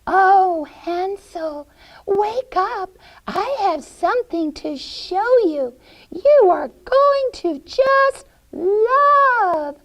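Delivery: put-on high, singsong old-lady voice; coaxing witch. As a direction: lure the boy sweetly, high-pitched. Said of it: "she went over and she says".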